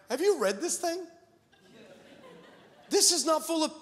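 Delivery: normal talking voice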